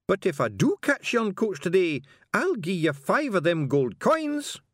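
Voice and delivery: high, slow Scots bargaining; insistent Scottish passenger. A narrator's reading. Passenger bribing the carter, high insistent Scots. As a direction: press the offer in high, drawn-out Scots.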